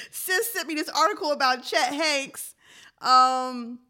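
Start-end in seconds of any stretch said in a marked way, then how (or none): none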